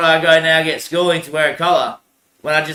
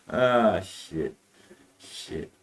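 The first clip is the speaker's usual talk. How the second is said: muttered curse; 8 semitones lower, 10 dB quieter, much slower than usual. Real, not acted. Curse after laughing.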